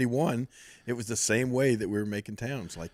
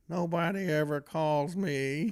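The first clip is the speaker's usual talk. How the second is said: slow, higher, mock self-pitying imitation; Terry Funk; 5 semitones higher, 3 dB quieter, slower than usual. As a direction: play the lonely old friend, drawn out. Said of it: "poor Terry says".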